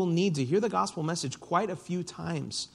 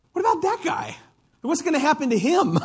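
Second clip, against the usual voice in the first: much higher, mock-curious squeak; Peter's nosy question; 13 semitones higher, 5 dB louder than usual. Peter deflecting about John, in a high mock voice. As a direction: jump way up, a nosy buddy pointing. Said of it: "He's like".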